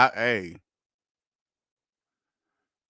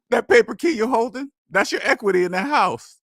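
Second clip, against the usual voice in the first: laughing; 6 semitones higher, 3 dB louder than usual. Real, not acted. Laughing while mocking paper key.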